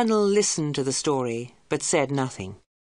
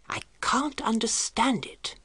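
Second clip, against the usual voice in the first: hushed, higher whisper; 7 semitones higher, 4 dB quieter, slower than usual. A narrator's reading. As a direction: whisper it in baffled unease. Puzzled Professor at supper.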